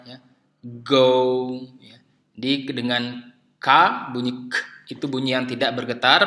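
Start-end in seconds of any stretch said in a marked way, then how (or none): 0.6-1.6 "go" with voiced g, held out; English g demo. Shown as a demonstration. Teacher giving an example of g, careful English "go". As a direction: model "go" as a separate word. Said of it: "dan g".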